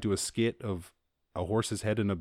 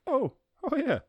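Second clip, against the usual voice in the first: surprised recognition. Retold or imitated. Recognizing a parodied scene.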